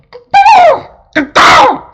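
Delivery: very high, loud bird squawk; crow caw. Acted. Angry pet crow answering the Baron, loud cawing. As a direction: screech out caws like a crow. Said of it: "said Edgar".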